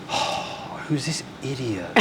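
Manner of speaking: a breathy, scornful aside under the breath; scornful inner thought. Retold or imitated. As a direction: hiss it like a thought she'd never say aloud. Guide's scornful look, unspoken thought.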